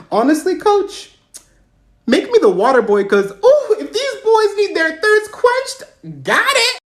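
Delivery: high, excited mock plea; eager, flirty plea. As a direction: gush at the coach, drawing words out. Eagerly begging coach for waterboy job.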